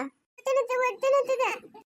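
high, tearful wail; pained, childlike crying; crying in labour. Acted. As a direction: wail urgently through the pain. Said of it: "ಏನಕ್ಕೆ ಇವಾಗ ನೀನ್ ಅಳ್ತಾ ಇರೋದು".

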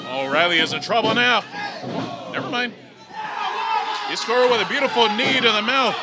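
ordinary delivery

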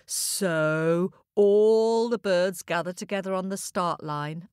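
usual speaking voice

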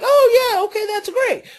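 high, bright-eyed mock agreement from a child; mock cheerful child. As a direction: squeak it out like an eager kid. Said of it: "the child's gonna be like".

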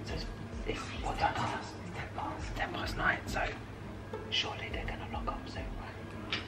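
hushed, nervous whisper; nervous night vlog whisper. Real, not acted. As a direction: whisper it, afraid of being caught. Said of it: "Right, you're whispering".

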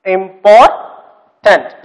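"Important" drawn out slowly, broken into syllables. Shown as a demonstration. Teacher showing children the syllables, "Important" slowly pulled apart. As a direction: split "Important" with a long gap before the last syllable. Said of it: "how many syllables are there".

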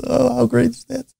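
high, gushing mock joy at seeing yourself; mock delight. User sees own Gravatar image, mock delighted squeal.

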